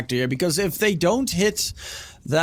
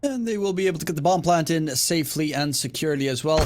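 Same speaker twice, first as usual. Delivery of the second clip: ordinary talk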